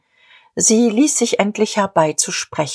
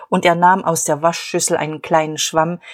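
ordinary delivery

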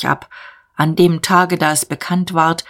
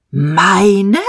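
stammered; 3 semitones higher, 5 dB louder than usual. A narrator's reading. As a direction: blurt it out in shock. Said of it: "stotterte Diederich".

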